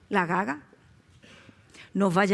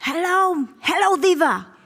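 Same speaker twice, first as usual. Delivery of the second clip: high, loud mock diva greeting; 8 semitones higher, 8 dB louder, slower than usual. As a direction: sing out a hammed-up star's hello. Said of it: "Y ella me dice".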